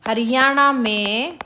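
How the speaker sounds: slow, word by word with long pauses; slow dictation. Shown as a demonstration. Teacher dictating the exam question to students, slow and spaced. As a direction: spell the question out slowly for notes. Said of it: "क्या question आ जाए".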